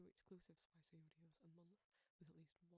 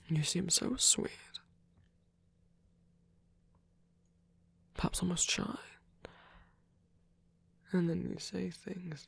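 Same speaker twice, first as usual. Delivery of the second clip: intimate whispered roleplay; 34 dB louder than usual. Acted. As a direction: whisper close and tender, like a shy caretaker.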